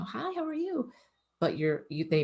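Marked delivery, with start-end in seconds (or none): 0.0-0.9 high-pitched friendly greeting, acted; soft, lilting high hello; relaxed greeting. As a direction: greet an animal in a high, easy voice. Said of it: "you're really relaxed and you say".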